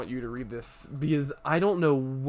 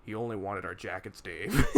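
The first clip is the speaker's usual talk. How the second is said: lower, quieter deadpan line; lightly voiced guard; 6 semitones lower, 5 dB quieter than usual. Retelling the guard scolding Dave, low deadpan. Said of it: "one of the guys turns".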